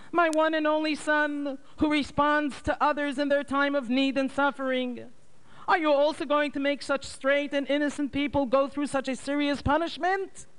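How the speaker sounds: very high pleading mother's voice; mother begs Nachmanson to take her, high and pleading; begging for mercy. A narrator's reading. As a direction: plead with the interrogator, voice way up. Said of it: "she begged with mercy".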